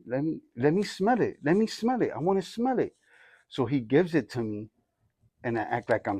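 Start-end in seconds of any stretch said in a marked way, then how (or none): 0.0-2.9 higher, softer imitation of an eager child; lightly voiced kid asking to have a go; pleading kid. Little son begging dad to smell it, pleading kid.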